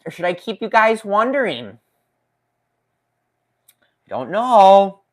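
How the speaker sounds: high, coy singsong questioning; playful teasing. Pretend. Streamer keeps chat guessing about saw models, playful teasing. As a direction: draw out the suspense, pitch swooping up.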